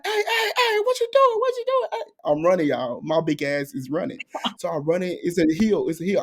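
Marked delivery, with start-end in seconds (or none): 0.1-2.1 very high calling voice of a man chasing; driver calling out. Retold or imitated. The driver calling after her as she runs, high and urgent.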